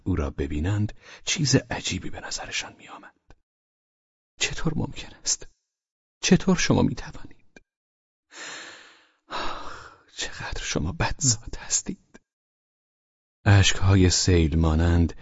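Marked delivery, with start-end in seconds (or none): 4.4-12.0 soft, high, whispery tearful reproach; half-choked young girl's protest, voice thin with tears. A narrator's reading.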